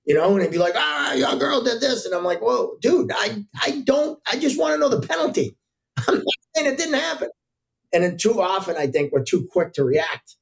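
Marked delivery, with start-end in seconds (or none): 0.8-2.1 high, slow mock yell from the ref; ref's yell. Referee yelling at the coach about his player, high mock shout. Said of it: "he'd start yelling at me". 2.4-7.3 taken aback. Retold or imitated. Coach backs off from yelling ref.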